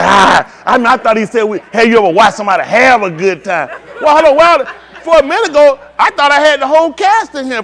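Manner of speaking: loud, high and worked up, calling over the room; excited calling out. Real, not acted. Excited host calling to crowd.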